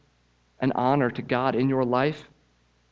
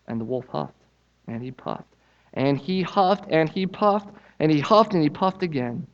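lively storybook reading, pitched up; story narration; 5 semitones higher, 6 dB louder than usual. A narrator's reading. Preacher reading the pigs story to church, animated. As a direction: tell it like reading to children. Reads children's story aloud.

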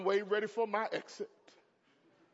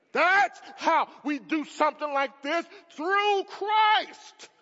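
louder and higher, shouting with joy; excited shout; 5 semitones higher, 6 dB louder, slower than usual. Real, not acted. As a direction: preach it out full force. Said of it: "I'm getting excited about the word of God".

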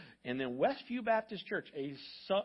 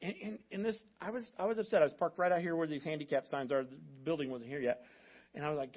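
ordinary talk

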